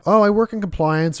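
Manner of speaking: put-on high girly voice; girlish reply. Imitating the club girl telling her job, high and girlish. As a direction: chirp it like the girl he met.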